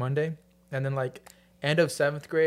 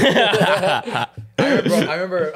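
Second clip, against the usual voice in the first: laughing through the words; can't stop laughing while talking; 6 semitones higher, 9 dB louder, slower than usual. Real, not acted. Laughing while starting a story.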